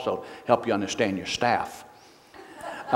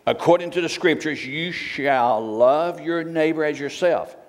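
higher and louder pulpit reading; preaching scripture; 3 semitones higher, 3 dB louder than usual. Acted. Preacher reads James aloud to the church, higher and louder. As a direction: proclaim the verses to the congregation.

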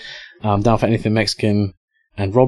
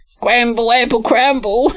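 high-pitched parody of a toy-ad shout; mock jingle voice; 4 dB louder than usual. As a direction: mimic the ad's battle cry up high. Mimics the jingle once more.

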